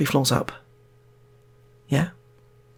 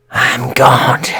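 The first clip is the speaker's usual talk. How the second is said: a touch of a loud boast; proclaiming; 3 semitones higher, 9 dB louder than usual. Acted. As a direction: announce it, louder, grandly. Brian proclaims himself god.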